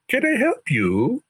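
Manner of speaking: sing-song European lilt, offering help; a young man's European accent, slow and lilting. Retold or imitated. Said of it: "says, in a lilting European accent".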